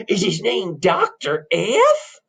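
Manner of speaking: high, very slow rustic question; countrified, drawn-out query; suspicious country bumpkin. Acted. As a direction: drag it out in mounting disbelief. Plays countrified twin brother.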